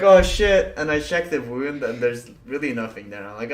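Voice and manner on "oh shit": alarmed. Retold or imitated. Retold alarm.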